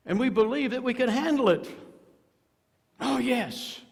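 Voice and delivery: high, loud overconfident boast; mock confident. Preacher mocking the self-assured sinner, loud and high. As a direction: sneer it out like a cocky sinner.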